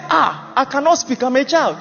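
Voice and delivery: Nigerian Pidgin protest. Acted. Jeremiah excusing himself to God, lightly acted Nigerian Pidgin protest. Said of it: "first time pidgin language was used".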